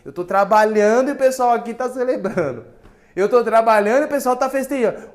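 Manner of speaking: loud, high protest; hammed-up outrage at the party; indignant older son. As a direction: rant that everyone is partying. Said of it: "Ele fala".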